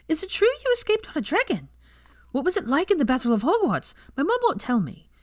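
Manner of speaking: put-on high, excited kid voice peppering questions; a wide-eyed first-year begging for gossip; eager young student. Student pestering Hermione with questions, in a high eager kid voice.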